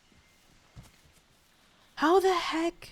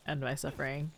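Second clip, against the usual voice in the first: mock despair; 7 semitones lower, 13 dB quieter than usual. Real, not acted. Character won't cooperate, pleading.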